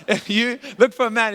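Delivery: laughing. Real, not acted. Joking advice to young ladies.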